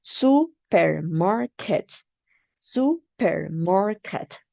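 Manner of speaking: supermarket said slowly, syllables stretched; slow clue. Shown as a demonstration. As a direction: give the class a slow hint. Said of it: "this is a clue".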